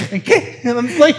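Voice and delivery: laughing. Pretend. Mock confusion while laughing.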